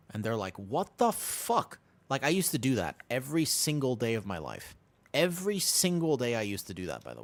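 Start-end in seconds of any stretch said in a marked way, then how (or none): 0.6-1.8 slow, higher, stunned what the fuck; imitating a confused stranger on a bus; baffled stranger. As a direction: drag it out in disbelief.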